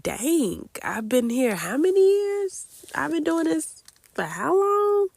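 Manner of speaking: half-imitated amazed inner voice, rising; stunned disbelief. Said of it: "when you just like".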